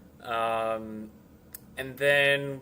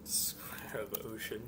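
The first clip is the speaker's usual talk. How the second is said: whispered mutter; 3 semitones lower, 11 dB quieter than usual. Real, not acted. Muttering to himself.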